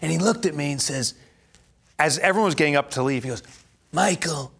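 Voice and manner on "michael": put-on Godfather accent. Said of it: "accent just like The Godfather".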